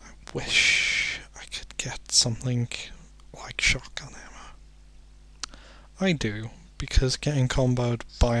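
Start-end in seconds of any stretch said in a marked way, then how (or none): 0.0-5.6 breathy whisper to himself, slowing right down. Real, not acted. Gamer alone in a dark horror level, whispering. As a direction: hush it out like a private wish.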